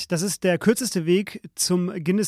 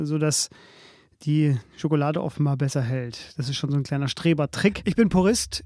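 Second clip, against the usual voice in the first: ordinary talk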